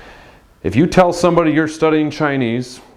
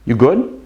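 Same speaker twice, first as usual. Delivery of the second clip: "You good" rising on GOOD; question. Shown as a demonstration. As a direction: turn "You good" into a question with a final lift.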